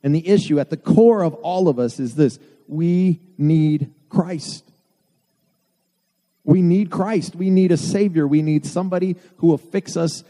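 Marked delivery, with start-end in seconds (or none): 2.7-4.6 drawn out, each word slow and weighty; slow emphatic. Real, not acted. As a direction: lean on "we need Christ" slowly, word by word. Preacher slows for emphasis.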